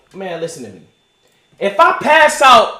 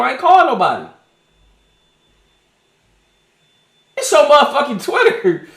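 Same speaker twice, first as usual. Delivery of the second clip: muted, offhand voice answering a call from the hospital bed; pretend phone call; 3 dB quieter than usual. Mock call from a hospital bed, muttered quietly. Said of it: "Imagine this phone call".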